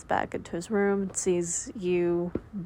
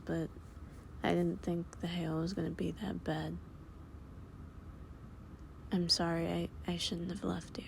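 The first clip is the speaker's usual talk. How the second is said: soft, hushed reassurance to a frightened partner; calm her down with gentle shushing and whispers; tender comforting boyfriend, very soft; soothing whisper; 11 dB quieter, faster than usual. Acted. Boyfriend comforting, offering to hold.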